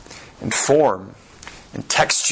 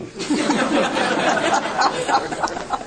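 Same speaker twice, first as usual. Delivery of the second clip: high laugh; 14 semitones higher than usual. Real, not acted. Laughs at own abstraction.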